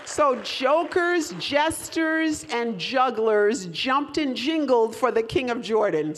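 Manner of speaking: slow, loud, every word spelled out; slow clear announcement. Shown as a demonstration. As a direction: announce it slowly so all can hear. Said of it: "you can all see".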